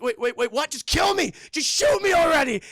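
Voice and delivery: high, loud frantic yelling; shouting. Retold or imitated. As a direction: yell it, panicked and high. Confused captor, then Mel yelling.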